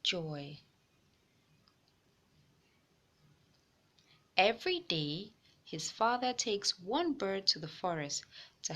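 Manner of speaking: put-on gentle read-aloud voice for kids; storybook reading for young listeners; story narration. A narrator's reading. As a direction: tell it like a picture book to children. Reading a picture book aloud.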